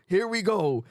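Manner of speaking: usual speaking voice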